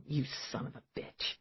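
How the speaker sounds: breathless hissed curse; whispered curse. Acted. Eve cursing the killer under her breath, whispered. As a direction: whisper it as a private threat. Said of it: "Eve thought".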